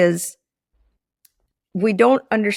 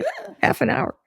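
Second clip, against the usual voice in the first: high, incredulous yelp; a wife balking at her husband's request for time; mock outrage; 6 semitones higher than usual. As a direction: balk at the half hour in disbelief. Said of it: "I was like".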